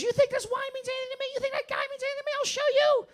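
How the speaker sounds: very high imitated woman's voice rising to a shout; hammed-up defiant drunken outburst; drunk defiance. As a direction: protest shrilly, building to a huge yell.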